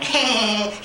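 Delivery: high cartoon cackle imitating SpongeBob; a squeaky mock cartoon laugh. Imitating SpongeBob's laugh from the show, high cackle. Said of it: "SpongeBob laughing".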